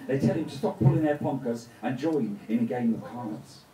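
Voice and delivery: plain reading voice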